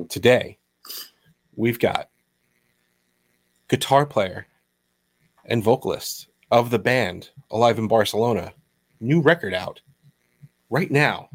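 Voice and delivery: measured announcer intro with long dramatic pauses; radio voice. Pretend. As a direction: introduce the guest like a radio host, pausing between phrases. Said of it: "my radio voice".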